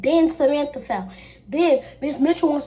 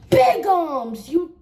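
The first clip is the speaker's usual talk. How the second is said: raised, higher mock cry; retold outburst; 6 semitones higher, 5 dB louder, much slower than usual. Friend wailing at her over a scratch, mock outcry. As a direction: call out an accusation, acted.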